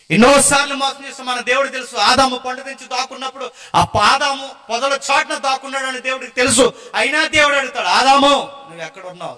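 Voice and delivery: loud fervent sermon; preaching at full force. Acted. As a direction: drive the point home to the congregation. Preacher raises voice to congregation.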